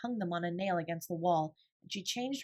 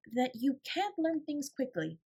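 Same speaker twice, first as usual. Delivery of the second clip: careful amendment; 7 semitones higher, slower than usual. A narrator's reading. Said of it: "amended Sarah".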